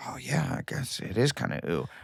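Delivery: pinching his fat at the mirror, quiet resigned admission; resigned to himself. Retold or imitated. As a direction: concede it quietly to your reflection. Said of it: "I'm going like".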